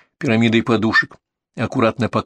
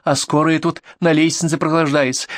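higher-pitched sarcastic reproach; indignant scolding; 6 semitones higher than usual. Acted. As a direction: scold the late medics with sarcasm. Angry neighbour scolding medics.

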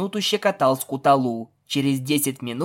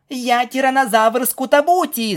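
high-pitched battle cry; playful shout; 10 semitones higher, 4 dB louder than usual. Acted. Pegasus attacking the stallion in play, high shout. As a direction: cry out like a child playing monster. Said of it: "закричала Пегаска".

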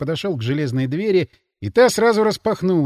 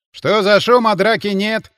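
raised, mildly growled question; 4 semitones higher, 6 dB louder than usual. A narrator's reading. Man behind the sentry checks on the noise, growling. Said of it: "рыкнул кто-то у него за спиной".

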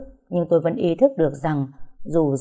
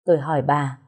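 usual speaking voice

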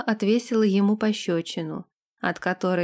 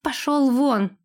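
blurted dismissal; 4 semitones higher, slower than usual. Acted. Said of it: "выпалила Лилейна".

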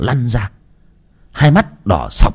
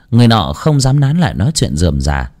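plain reading voice

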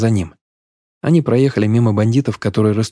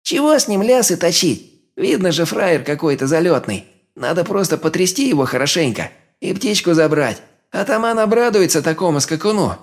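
squeaky, much higher thug; 8 semitones higher than usual. A narrator's reading. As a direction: whine it out in a squeak. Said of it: "писклявым голосом сказал он".

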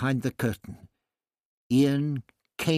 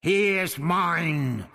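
high, slow, drawn-out roar; 6 semitones higher, 3 dB louder, much slower than usual. A narrator's reading. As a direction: roar the claim, stretching each word. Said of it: "Ixta roared".

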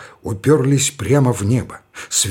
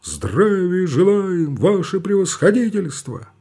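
drilled reply; 6 semitones higher than usual. Acted.

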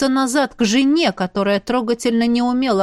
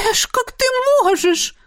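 put-on much higher, outraged girl's cry; indignant; 13 semitones higher than usual. A narrator's reading. Izzy protests at Ash calling her dresses vulgar, high and indignant. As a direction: protest like a wounded young lady. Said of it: "возмутилась она".

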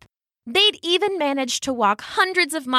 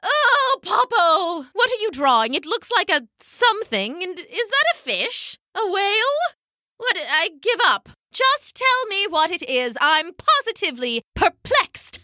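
high, flustered elder penguin; high-pitched exasperation swooping through questions; exasperated Pippo; 10 semitones higher than usual. A narrator's reading. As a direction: burst out with baffled impatience. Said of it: "Pippo couldn't take it anymore".

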